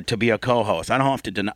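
usual speaking voice

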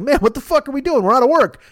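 complaining monsters. Acted. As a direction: whine like out-of-work cartoon villains. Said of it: "they go and they talk to the Mucinex guy".

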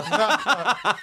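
high burst of laughter; laughing at his own bit. Real, not acted. Laughs while retelling his bit.

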